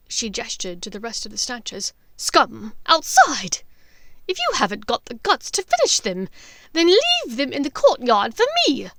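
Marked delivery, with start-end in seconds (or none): 2.2-8.9 drip scorn on the scum; contemptuous witch. Acted. Bellatrix sneering orders.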